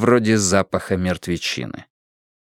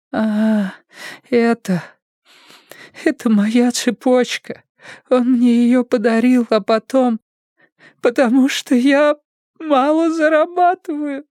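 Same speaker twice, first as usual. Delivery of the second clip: high, slow, tearful whimper; tearful girl, voice pitched way up; breaking into tears; slower than usual. A narrator's reading. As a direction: stammer it out as the crying takes over. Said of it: "расплакалась уже не на шутку".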